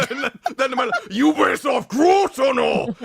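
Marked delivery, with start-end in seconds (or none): 1.1-2.9 loud, higher hammed-up manly yell; mock macho shout. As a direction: bark it like a fierce warrior. Men's version, after girls' voice.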